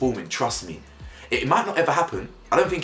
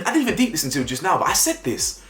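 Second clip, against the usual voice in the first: excited; 11 semitones higher than usual. Real, not acted. Vlogger thrilled his prediction came true.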